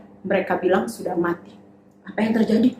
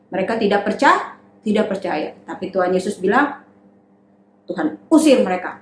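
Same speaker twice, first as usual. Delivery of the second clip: ordinary delivery, nothing marked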